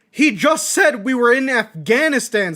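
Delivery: high, loud disbelief; exasperated, loud objection; incredulous protest. Acted. Bewildered protest at detective's claim.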